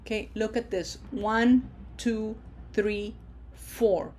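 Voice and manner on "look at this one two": ordinary talk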